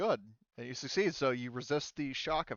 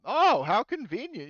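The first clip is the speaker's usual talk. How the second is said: mock-delighted, higher and louder; sarcastic delight; 10 semitones higher, 9 dB louder than usual. Real, not acted. GM gloating over a lucky crit, sarcastic delight. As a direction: relish the lucky roll.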